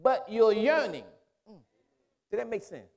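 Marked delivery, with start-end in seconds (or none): 0.0-1.0 high, loud, rolling preacher's cadence; full-force preaching. Acted. Preacher pressing the church on the Spirit, full-force preaching.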